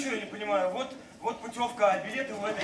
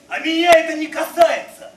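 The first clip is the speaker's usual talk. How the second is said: loud; 9 semitones higher, 12 dB louder than usual. Acted. Peasant boasting loudly.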